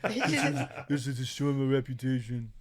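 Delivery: Trump voice; Trump impression. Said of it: "I'm doing Trump on accident".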